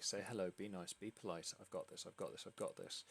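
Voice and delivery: soft, low, under-the-breath pep talk; quietly rehearsing reassurances to oneself; self-coaching mutter. Acted. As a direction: murmur it to yourself like a nervous mantra. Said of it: "completely oblivious because you're focusing on".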